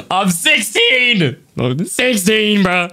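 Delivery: high, slowly drawn-out protest; exasperated, drawn-out I'm sixteen; exasperated insisting. Real, not acted. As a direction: stretch every word to make chat believe it. Chat keeps doubting his age.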